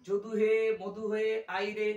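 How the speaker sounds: "যদু হে, মধু হে, আই রে" with long-held final vowels; drawn-out calling. Shown as a demonstration. Teacher showing how to call someone, vowels drawn out in "হে" and "রে". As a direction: call out, stretching "হে" and "রে" long and loud. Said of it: "কাউকে ডাকার জন্য".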